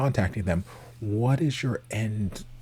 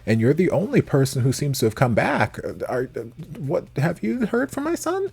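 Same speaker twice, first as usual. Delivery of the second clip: lightly acted parent, pitched higher; higher-pitched mock questioning; imagined parent asking; 8 semitones higher, 3 dB louder than usual. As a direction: play a parent anxiously questioning their child's friend. Voices a friend's worried family member.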